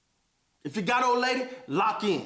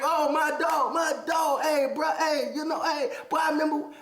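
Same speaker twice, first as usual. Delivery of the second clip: mock fake friend greeting; 7 semitones higher than usual. Mimicking a fake old friend calling out to him. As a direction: ham up the phony old buddy.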